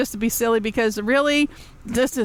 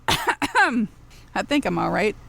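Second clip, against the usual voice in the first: ordinary talk, nothing marked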